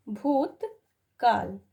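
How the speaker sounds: भूतकाल stretched out slowly; spelling it out slowly for the class; slow dictation. Shown as a demonstration. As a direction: draw out भूतकाल syllable by syllable.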